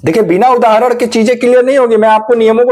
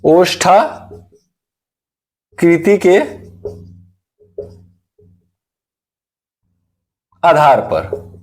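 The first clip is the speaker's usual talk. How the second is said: slow dictation; 4 semitones lower, much slower than usual. Shown as a demonstration. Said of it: "चौथा आपका होगा ओष्ठाकृति के आधार पे।".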